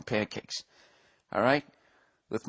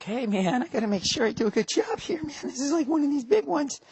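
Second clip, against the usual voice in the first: much higher, fretful pep talk to himself; nervous inner voice; 13 semitones higher, 3 dB quieter than usual. Retold or imitated. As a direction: psych yourself up like an anxious guy. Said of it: "I was too busy going like".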